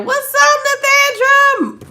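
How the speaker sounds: high, loud squealed hello; gleeful high-pitched call-out; excited greeting. Real, not acted. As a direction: sing out the greeting way up high, name stretched. Calling out greeting to arriving viewer.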